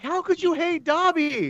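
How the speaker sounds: high-pitched incredulous imitation; mock outrage. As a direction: squeal it like an appalled fan, mockingly. Mimics shocked reaction of others.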